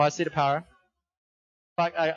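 ordinary talk, nothing marked

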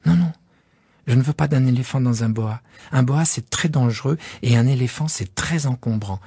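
put-on a small boy insisting on his sheep; young prince pressing his request; little prince. Child prince asks for sheep.

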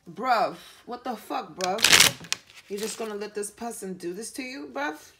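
lightly put-on London street accent, "bruv" stretched.

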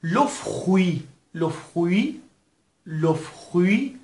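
slow لو فروی, R turned to KH, no final T. Shown as a demonstration. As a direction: model لو فروی with KH after F. (لو فروی، آهسته با صدای خ)

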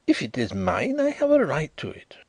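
high, sharp exclamation; snatch the words out; exclaiming. A narrator's reading. Young man grabs the ruler from Carrados, exclaiming. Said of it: "he exclaimed".

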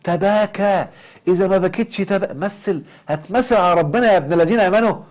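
high, loud mock sermon voice, mimicking; mocking preacher. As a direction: ham up a pushy religious teacher. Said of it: "يقول لك إيه؟". (تقليد ساخر لواعظ بصوت عالٍ)

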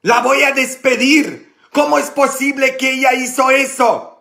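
high, loud angry outburst; furious. Retold or imitated. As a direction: spit it out like an enraged priest. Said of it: "Ah, tan furioso".

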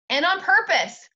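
put-on high loud tattling child; indignant tattling. A narrator's reading. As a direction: tell on her, outraged. Boy tattling to mother.

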